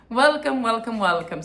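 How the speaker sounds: bright, loud greeting to listeners; excited welcome. Real, not acted. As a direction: throw out a big happy welcome. Greeting podcast viewers.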